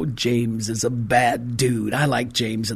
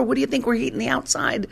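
exasperated mom; 10 semitones higher, faster than usual. Retold or imitated. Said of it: "And I go".

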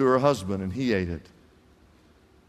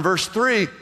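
ordinary delivery

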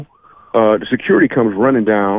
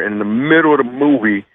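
ordinary delivery

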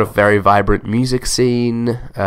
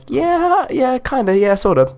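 high sing-song falling yeahs; mock high Aussie yeah; slower than usual. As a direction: play up a squeaky unsure agreement. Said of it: "I'm like".

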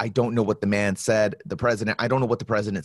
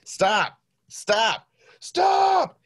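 climbing from calm to a high shout; building yell; 12 semitones higher, much slower than usual. Retold or imitated. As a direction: NCO at the wash rack, stop rising to a shout. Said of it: "And he's like".

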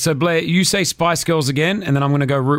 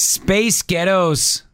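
slow, raised American accent; trying on American, slow and higher; American accent attempt; 4 semitones higher, much slower than usual. Shown as a demonstration. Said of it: "so let me do American".

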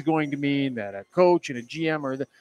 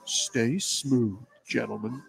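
a lightly lowered, weak attempt at a deep voiceover; half-hearted deep announcer voice; bad broadcast voice; 5 semitones lower, 8 dB quieter, slower than usual. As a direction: try for bass and fall short. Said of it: "I got the bad broadcast voice".

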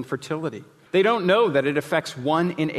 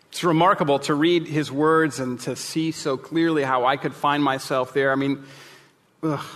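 ordinary talk

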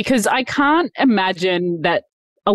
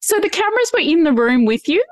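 tender sympathy; 10 semitones higher than usual. Real, not acted. Host moved by guest's tears.

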